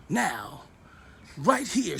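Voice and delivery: ordinary talk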